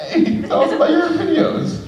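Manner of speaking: way up high, laughing singsong; high teasing coworkers. Retold or imitated. Coworkers teasing her the next day, high laughing singsong. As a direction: tease her with sugary glee.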